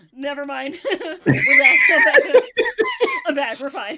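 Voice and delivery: loud high laughing shout; can't stop laughing. Real, not acted. Still laughing coming back.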